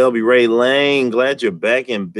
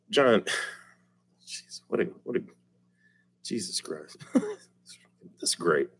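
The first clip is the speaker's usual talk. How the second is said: out of breath; 10 dB quieter than usual. Real, not acted. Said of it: "I'm out of breath".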